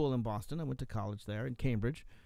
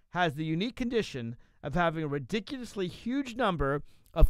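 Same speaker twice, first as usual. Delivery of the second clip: higher, punchy reading building to a loud finish; animated quote reading; 5 semitones higher, 6 dB louder than usual. Acted. Reads a blog post aloud.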